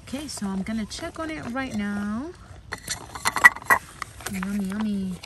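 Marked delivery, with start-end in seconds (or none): none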